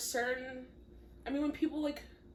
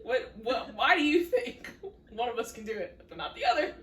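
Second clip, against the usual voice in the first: laughing; 2 semitones higher, 6 dB louder than usual. Real, not acted. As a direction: laugh through the question. Laughing mock question.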